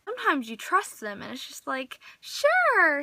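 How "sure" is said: eager yes. Pretend. Happily lending to a friend.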